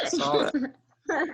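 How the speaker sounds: slow, high, through laughter; drawn out and laughing, amused. Real, not acted. Repeats while laughing.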